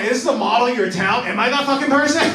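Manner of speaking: high, loud panicked disbelief; freaking out that you're the next victim; panicked yell. Retold or imitated. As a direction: shriek it in disbelief.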